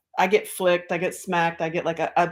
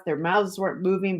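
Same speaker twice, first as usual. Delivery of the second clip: usual speaking voice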